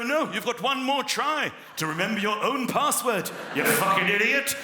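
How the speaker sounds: loud insulting computer; rude website voice. Pretend. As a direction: sneer at the user.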